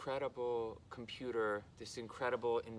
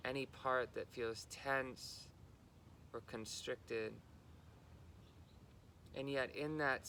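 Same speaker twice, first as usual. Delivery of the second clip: unhurried guidance, level in pitch, pausing between phrases; guided meditation. Real, not acted. Guiding listeners into body and breath, calm meditation delivery.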